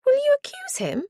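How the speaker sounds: high squeak on the question; squeaky voice. A narrator's reading. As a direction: let the voice squeak up. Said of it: "Lizzie's voice squeaked a little".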